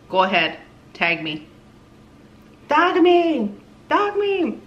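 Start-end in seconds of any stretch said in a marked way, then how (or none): 2.7-4.6 high, bright excited shout; excited call. Real, not acted. Calling out to viewers.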